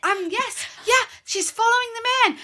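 high-pitched voice. Retold or imitated. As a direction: squeak it out eagerly. Imitating her inner listening.

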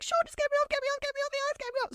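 high, frantic repeated begging; panicked plea. Retold or imitated. Girlfriend begging boyfriend to get her off the ice, frantic and high. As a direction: beg to be pulled off the ice in a panic.

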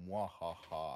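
mock evil mwahaha; a low hammed-up evil chuckle; villain laugh. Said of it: "the classic, the evil C E O that".

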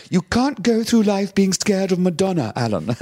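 high, slow imitation of a grand co-star; mock scolding. Co-star rebukes him over fearing [name], high mock scolding. [name] scolding [name], acted.

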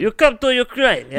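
high, loud hammed-up Ukrainian accent; mock Ukrainian accent. Podcast host trying a Ukrainian accent for fun, hammed-up Ukrainian accent. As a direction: play a cheesy Ukrainian tour guide, bad Ukrainian accent. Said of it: "I can't do a good Ukrainian accent".

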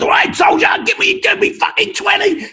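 high, loud barking drill instructor; drill sergeant yell. Retold or imitated. Drill instructor shouting an order at a recruit, loud mock bark.

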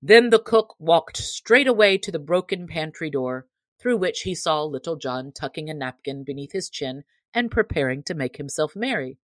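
ordinary delivery, nothing marked

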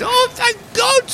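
very high, slow relieved goblin. Acted. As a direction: sigh it out high like a little goblin. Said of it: "And he goes".

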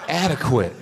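laughing disbelief at the word; repeating it through laughter. Real, not acted. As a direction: say it again, still laughing.

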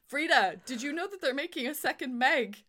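thrilled squeal of a fan; excited. Real, not acted. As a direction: bubble over with glee about the sequel. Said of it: "I'm so excited".

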